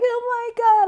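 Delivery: very high mock gushing; gushing admirer. Daughter mimicking people who fawn over her, gushing. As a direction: squeal it like an overexcited stranger.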